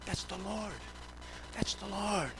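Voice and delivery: very soft, hushed repetition; imitated whisper. Preacher recalling all he could hear in prayer, imitated whisper. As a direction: say it under the breath, reverently hushed. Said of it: "in a whisper, like".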